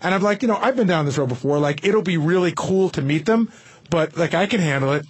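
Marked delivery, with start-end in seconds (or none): none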